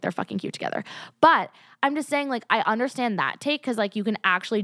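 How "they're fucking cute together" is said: a much lower, softer confession that they're cute; low gushing aside. Retold or imitated. To viewers, admitting the couple is cute: low, soft gush. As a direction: drop way lower and gush under the breath. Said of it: "I'm like".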